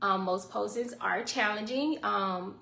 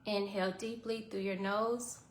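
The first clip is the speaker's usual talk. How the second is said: guided meditation; 7 dB quieter than usual. Acted. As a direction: calmly guide the breathing. Leads a short guided meditation.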